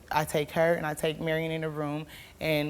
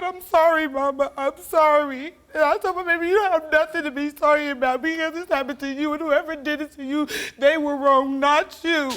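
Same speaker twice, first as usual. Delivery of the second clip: high, loud, near tears; emotional, louder and higher; tearful; 8 semitones higher, 7 dB louder than usual. Real, not acted. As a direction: speak through rising tears. Emotional retelling daughter's apology.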